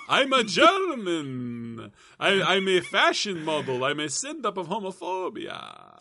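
put-on slow, high, drawn-out German accent; Bruno, German accent. Introducing himself as a fashion model, hammed-up German accent. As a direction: stretch each phrase in a showy German accent. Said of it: "That was a German accent".

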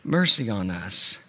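slow, subdued begging for mercy; desperate plea. Retold or imitated. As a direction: imitate quiet desperation, pausing after the first word. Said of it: "nothing but complete desperation".

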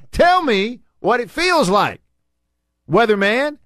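high-pitched, emphatic protest; exasperated rant. Real, not acted. Radio host ranting at the weatherman about feels-like temperatures, exasperated. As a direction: argue it indignantly, voice riding high.

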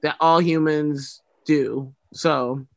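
ordinary delivery

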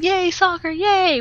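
high, sarcastic "Yay, soccer, yay!" with both yays thrown up; mock the family's sudden love of soccer; sarcastic cheer.

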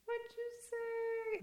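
slow, very high catchphrase; sing-song catchphrase. Retold or imitated. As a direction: deliver it like a sitcom punchline. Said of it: "what would you say is the difference".

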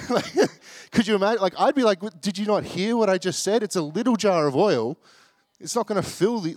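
high, loud incredulous outburst; incredulous protest. Acted. Imagines being the mum, protesting incredulously.

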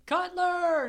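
high, drawn-out shout of a surname; imitated parade-ground holler; mock military call. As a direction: call it out high like a drill officer. Voices officer calling the cadet.

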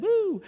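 high "woo"; celebratory whoop. Retold or imitated. Man hearing he has a new friend, celebratory whoop. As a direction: let out a high, held whoop, acting out delight. Retold delight at gaining friend.

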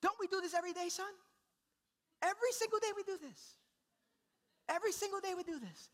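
put-on high-pitched pleading with a baby; worked-up dad reasoning with an infant; exasperated parent. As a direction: protest to your son in a high, strained voice. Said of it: "I start saying".

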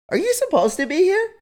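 high prim questioning lady voice; suspicious lady. Retold or imitated. Lady checking if he belongs, high prim suspicious voice. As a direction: play the suite attendant doubting him. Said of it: "she's like".

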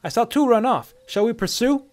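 put-on high, eager young soldier voice; younger soldier. A narrator's reading. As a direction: call it out like a junior trooper. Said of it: "This voice sounded younger".

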